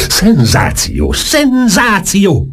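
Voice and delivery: high, drawn-out cry of excitement; elated high-pitched exclamation; excited yelling. A narrator's reading. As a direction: draw the word out in a high, thrilled cry. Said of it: "ordította".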